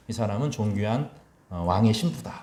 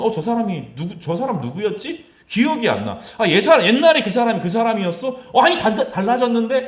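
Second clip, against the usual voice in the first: higher, louder acted astonishment; amazed disbelief; 9 semitones higher, 5 dB louder than usual. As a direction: gasp at a changed face, half laughing. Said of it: "이렇게 말하는 거예요". (높고 놀란 목소리로)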